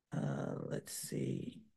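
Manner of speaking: whispered to self while searching; whispered muttering. Real, not acted. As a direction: breathe it to yourself, slowly. Searching for lost file.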